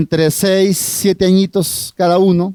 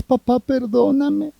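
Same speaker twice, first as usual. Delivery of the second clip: put-on high, soft, slow little girl's plea; a child asking forgiveness, timid and high; lightly voiced small girl, soft and pleading; pleading little daughter; 5 semitones higher, 5 dB quieter, slower than usual.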